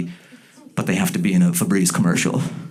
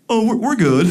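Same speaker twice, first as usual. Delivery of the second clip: dismissive couple; 14 semitones higher, 4 dB louder than usual. Retold or imitated. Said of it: "And they go".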